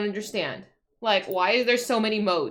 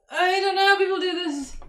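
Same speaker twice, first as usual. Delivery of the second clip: high, exasperated whine; 9 semitones higher, 4 dB louder than usual. Real, not acted. Streamer baffled by a fiddly task, exasperated. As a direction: say it higher, fed up with the task.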